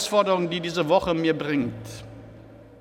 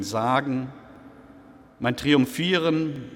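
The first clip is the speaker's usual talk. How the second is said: slow, measured poem reading with long pauses; poem recitation; slower than usual. Acted. Preacher reads the dying cabaret artist's poem to the congregation, slow and measured. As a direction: recite the dying man's verses thoughtfully.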